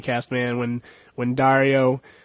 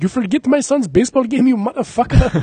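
much higher, louder hammed-up accent; mock Indian accent; 9 semitones higher, 5 dB louder than usual. Comedian quotes the character scolding in a high mock accent. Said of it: "He's like".

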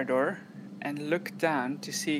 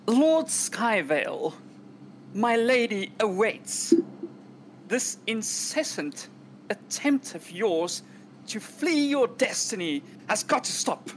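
raised-voice yell; 5 semitones higher than usual. Acted. Butler yells down the corridor at fleeing lord, raised voice. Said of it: "I'm gonna raise my voice".

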